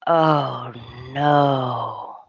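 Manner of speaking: low, crying oh no; sinking into dismay. Retold or imitated. Thomas realising the truth, low and dismayed. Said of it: "he's like".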